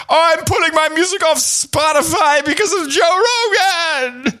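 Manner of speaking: high, slow whiny mimicry; mocking whiny voice. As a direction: whine it like a diva. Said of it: "and with his".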